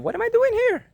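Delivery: very high, loud, desperate cry; pleading seeker. Pretend. Lost seeker crying out at the universe, very high. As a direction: throw the question up to the cosmos. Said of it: "you ask that".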